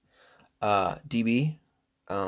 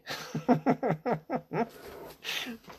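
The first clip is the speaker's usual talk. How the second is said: high cracking laugh; 14 semitones higher, 4 dB quieter than usual. Real, not acted. Laughs at own words.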